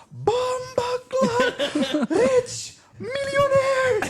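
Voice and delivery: very high, drawn-out Jamaican yell, laughing; Jamaican shout. Retold or imitated. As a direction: scream it slowly in mock Jamaican amazement. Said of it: "He's like".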